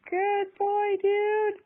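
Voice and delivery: high excited praise. Real, not acted. Praising toddler.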